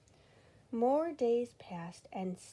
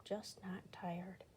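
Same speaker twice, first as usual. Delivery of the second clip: slow whispered excuse; soft slow whisper; murmured; 8 dB quieter, much slower than usual. Acted. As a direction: whisper it, unconvinced. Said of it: "he murmured".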